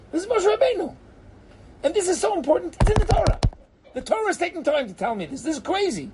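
high-pitched, astonished exclaiming; excited disbelief; incredulous amazement. Real, not acted. Teacher amazed at the verses.

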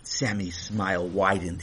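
plain reading voice

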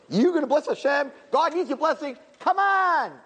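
very high, jeering and incredulous; scornful satan. Acted. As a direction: scoff at the little worm from above. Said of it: "he's like".

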